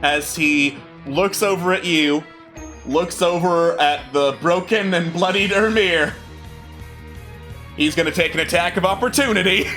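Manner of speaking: ordinary talk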